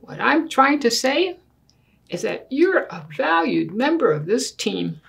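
put-on lively storybook read in a child's earnest voice; reading to children. Child argues with Daddy over the big bed, earnest storybook reading. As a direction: read it like a kid making a serious case. Reads picture book aloud.

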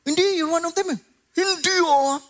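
sing-song Ilonggo lilt on Hindi; Ilonggo accent. Acted. Peter denying to the servant girl in Ilonggo accent. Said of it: "Umiba yung accent, naging Ilonggo eh".

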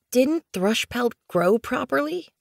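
high, small questioning kit; mewing kitten. A narrator's reading. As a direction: mew the question up high to mother. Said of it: "Blue Kit mewed to her mother".

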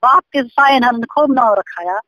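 ordinary talk

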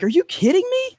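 very high-pitched disbelief; mock incredulity. Wrestler told the crowd is mad, incredulous retort.

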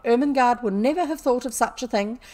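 loud, scornful accusation; fierce scolding. A narrator's reading. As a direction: rail at the culprit in anger. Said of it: "she cried".

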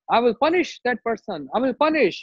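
acted indignant outburst; angry threat. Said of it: "So much angry mood".

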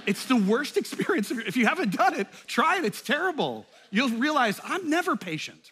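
high, loud comic exasperation; exasperated rant. Real, not acted. Preacher joking to congregation, high loud exasperation. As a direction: throw up your hands at it.

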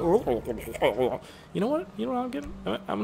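gurgly, muffled talking-into-a-cup voice; bubble in throat. Shown as a demonstration. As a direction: speak through an uncleared throat bubble, softly. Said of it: "sounds like you're talking into a cup".

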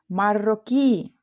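Маррокі, slow, with a long stressed final і; stress on last syllable. Shown as a demonstration. Teacher models the Moroccan nationality, final і stressed in Маррокі. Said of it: "Наголос на останньому складі".

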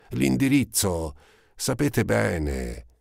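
high, then slow and dragged out; forced effort. A narrator's reading. Eponine gives Marius the address with visible effort. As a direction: squeeze the words out reluctantly. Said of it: "come facendo uno sforzo".

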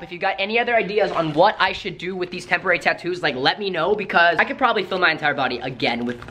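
ordinary talk, nothing marked

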